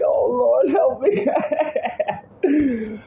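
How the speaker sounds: very high, very slow, half-laughing plea; mock desperate prayer. Scared ten-year-old begging God for help, high and laughing. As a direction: stretch out a frightened little prayer. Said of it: "I'm crying inside".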